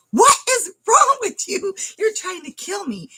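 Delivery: high-pitched outraged mock scream; re-enacted panicked accusation; retold screaming. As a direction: shriek it in outrage without full volume. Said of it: "So then I start screaming".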